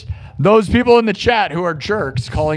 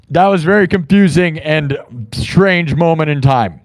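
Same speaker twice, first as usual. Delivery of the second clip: usual speaking voice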